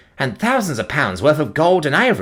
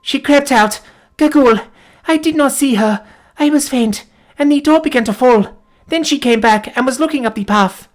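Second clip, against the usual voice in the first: put-on wounded girl telling what happened; dying young woman; 11 semitones higher, 4 dB louder than usual. A narrator's reading. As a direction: tell it as your strength ebbs. Voices the dying Folata.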